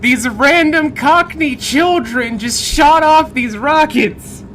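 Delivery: high, loud pretend protest; hammed-up denial; mock defensive voice. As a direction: protest innocence in a silly voice. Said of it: "it's like".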